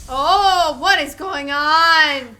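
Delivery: loud, slow bewildered gasp of a question; startled. Real, not acted. Streamer reacting to a shock on screen, loud and bewildered. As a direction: caught off guard, drawn out and loud. Startled by sudden game scene.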